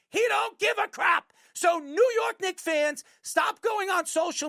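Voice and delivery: loud, high rant at the fans; shouting. Real, not acted. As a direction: yell it out like a fed-up fan. Ranting at Knicks fans.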